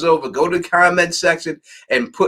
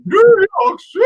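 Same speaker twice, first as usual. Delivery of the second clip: very high, loud, cracking into laughter; repeating the ad line, shrieking and laughing; laughing shout; 6 dB louder than usual. Retold or imitated. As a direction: scream it as the joke breaks you up. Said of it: "that salsa commercial".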